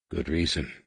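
low, soft, terse retort; weak retort. A narrator's reading. Wounded Anakin answering his brother back, a quiet retort. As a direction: answer back with little strength. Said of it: "Anakin retorted".

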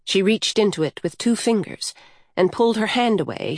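usual speaking voice

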